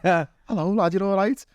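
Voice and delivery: high Scouse lilt, playing along; mock Scouse greeting. Acting out his reply in kind.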